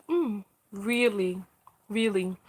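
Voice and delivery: low, soft, slow disbelief; quietly deadpan doubt, dropped well down; unimpressed. Retold or imitated. Speaker recalls reacting to the mother's complaint, unimpressed.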